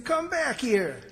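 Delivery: calling Sister Sampson back as she hurries off; calling after. Acted. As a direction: call her back before she's gone. Calling after departing woman.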